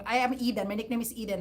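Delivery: ordinary talk, nothing marked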